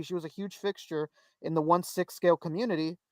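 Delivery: usual speaking voice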